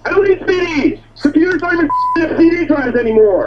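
high-pitched, exasperated protest; irate. Real, not acted. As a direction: reject it as a fed-up customer. Customer rejects the offer angrily.

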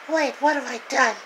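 very high, loud squeaky cartoon voice; high cartoonish squeal with laughter. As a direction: play a silly squeaky sidekick. Character voices arguing about beans.

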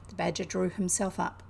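usual speaking voice

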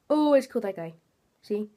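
ordinary delivery

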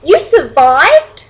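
high, loud, drawn-out question; amazed cry. Acted. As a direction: exclaim it slowly in disbelief. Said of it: "cried Stella Luna".

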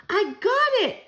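high, loud cry of discovery; excited shout. A narrator's reading. Child hits on the answer, shouting excitedly. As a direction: burst out, pitch leaping up. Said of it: "I shouted".

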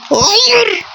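high despairing wail of no; anguished cry. Real, not acted. Gamer seeing another goal go in, high wail. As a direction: let the no break high in real despair. Opponent scores again.

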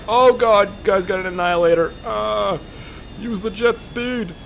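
high-pitched alarm; panicked reaction. Real, not acted. Gamer facing an enemy's heavy weapon, high panicked cries. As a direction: blurt it out while fleeing.

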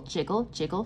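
ordinary delivery

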